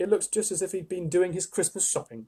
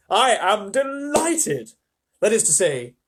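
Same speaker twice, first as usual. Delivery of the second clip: higher, louder, drawn out slowly; a touch of hammed-up delight; delighted fawn; 5 semitones higher, 6 dB louder, slower than usual. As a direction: savour the delight.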